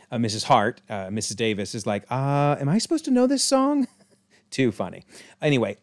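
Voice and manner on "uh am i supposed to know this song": put-on high, puzzled little old lady; lost in the middle of the song; bewildered neighbour asking aloud; confused old lady. Mrs Davis confused during chant.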